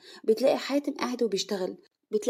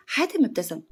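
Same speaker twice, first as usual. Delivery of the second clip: ordinary delivery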